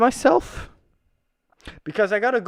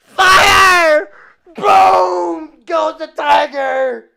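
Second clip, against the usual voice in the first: shouting; 7 semitones higher, 9 dB louder, much slower than usual. Acted. Acting out a tank fight.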